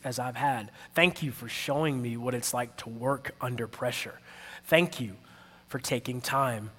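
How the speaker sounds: soft, low, measured gratitude; sincere thanks. Retold or imitated. Thank you card to a difficult manager, quietly sincere. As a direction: quietly list each thanks, pausing between phrases. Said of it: "So I said".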